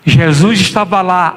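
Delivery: usual speaking voice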